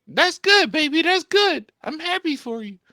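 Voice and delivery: very high, loud squeal of encouragement; mock supportive girlfriend. Girlfriend cheering his goals, mockingly squealed. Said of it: "she used to be like".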